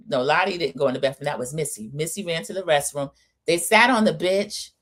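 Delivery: ordinary talk, nothing marked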